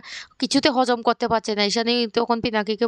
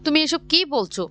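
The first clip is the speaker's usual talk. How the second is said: higher-pitched startled question; a touch of shock, pitched up; taken aback; 6 semitones higher than usual. A narrator's reading. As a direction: ask it in mild narrated disbelief. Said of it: "পিনাকিও রীতিমতো চমকে যায়".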